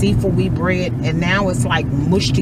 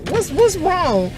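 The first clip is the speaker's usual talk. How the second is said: husband's alarm; 13 semitones higher than usual. Retold or imitated. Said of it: "He's like".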